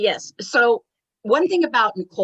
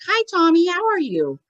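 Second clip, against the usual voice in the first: excited greeting; 10 semitones higher than usual. Retold or imitated. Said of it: "she's like".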